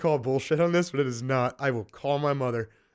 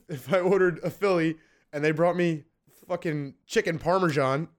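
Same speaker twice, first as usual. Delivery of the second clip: ordinary talk, nothing marked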